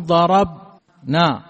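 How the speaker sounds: ضربنا drawn out very slowly and loud, the نون fully sounded; stretched, loud demo. Shown as a demonstration. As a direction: drag out ضربنا loudly to show the moving نون. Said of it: "نقول". (ضربنا ببطء شديد وبصوت عالٍ مع إبراز النون)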